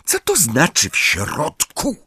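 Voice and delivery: high, indignant challenge; understated cross disbelief; angry question. A narrator's reading. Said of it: "zapytała ze złością Hermiona".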